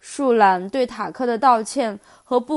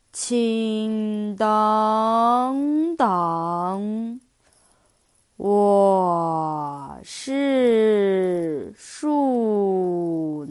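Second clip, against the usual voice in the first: very slow, drawn-out sloth voice; super slow sleepy sloth; much slower than usual. Acted. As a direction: stretch every word like a sleepy sloth. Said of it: "一句拖了很长音的回答".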